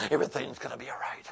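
fast hushed whisper. Retold or imitated. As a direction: breathe it out with no voice. Parents hoping quietly.

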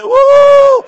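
high, loud ecstatic whoop; preacher whooping with joy; joyful whoop. Real, not acted. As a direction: let out a huge joyful woo. Ecstatic cry at sermon peak.

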